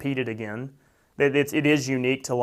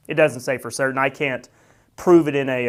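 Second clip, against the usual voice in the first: ordinary delivery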